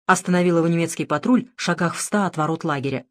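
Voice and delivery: plain reading voice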